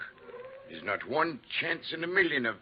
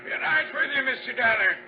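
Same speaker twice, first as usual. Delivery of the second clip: much louder and higher, called over machine noise; calling out; 12 semitones higher, 6 dB louder than usual. Acted. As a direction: shout it across the mill over the saw.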